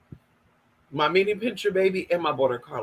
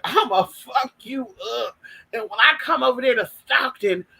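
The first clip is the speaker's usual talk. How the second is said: mock-furious rant in a raised put-on voice; upset threat; 5 semitones higher than usual. Retelling a jealous man's threats, upset and higher-pitched. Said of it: "Girl, he was so upset".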